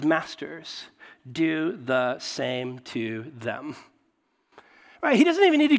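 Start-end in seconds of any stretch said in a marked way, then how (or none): 0.0-3.8 very slow, lower and measured; deliberate verse quote. Retold or imitated. Preacher quoting Paul's key six words to the congregation, slow and deliberate. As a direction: let each word land, slowly.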